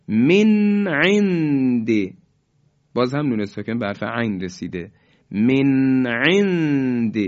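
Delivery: slow recitation, nun sounded clearly with no hold; Quranic recitation, clear izhar. Shown as a demonstration. Teacher reciting the correct examples, slow clear izhar. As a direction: recite slowly and pronounce the nun cleanly.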